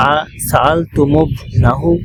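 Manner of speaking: সাআলতুমুবনাহু drawn out very slowly, মিম linked with dhamma; slow joined reading. Shown as a demonstration. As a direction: stretch আ সাআলতুমুবনাহু syllable by syllable. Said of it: "মিমে দম্মা দেওয়া হয়েছে".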